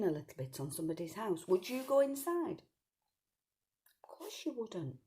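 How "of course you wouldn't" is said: put-on soft whispered aside to the children. A narrator's reading. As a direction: let it drop to a secret whisper. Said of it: "aside to child listeners".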